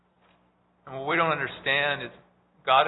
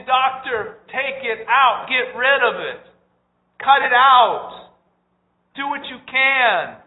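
urgent plea to doctor; 8 semitones higher, 7 dB louder, slower than usual. Retold or imitated. Parent pleading with doctor.

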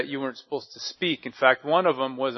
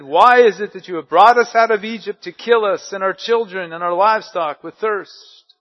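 1 complaining Israelites; 4 semitones higher, 9 dB louder than usual. Acted. People complained against Moses.

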